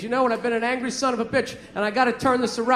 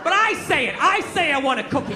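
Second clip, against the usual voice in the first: ordinary delivery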